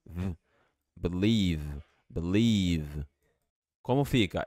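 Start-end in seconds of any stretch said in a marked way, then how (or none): 0.9-3.0 Believe as bliv ending on a real V; clear V sound. Shown as a demonstration. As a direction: finish with a buzzing V. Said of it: "V vai ficar com som de V".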